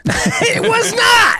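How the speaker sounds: high, drawn-out incredulous cry; laughing disbelief. Real, not acted. Amazed listener reacts to story.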